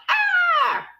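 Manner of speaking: sudden high piercing yelled "Ah!"; imitating a yeller's sharp cry; mock yell. Shown as a demonstration. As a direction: fire off one short high shout. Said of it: "We mean he yells like".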